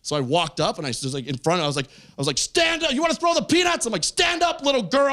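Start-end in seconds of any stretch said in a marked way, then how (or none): 2.4-5.1 high, strained mock shouting; scary Hulk-style threat. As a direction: bellow like a comic Hulk, pitched way up. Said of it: "I'm gonna scare him like that Incredible Hulk".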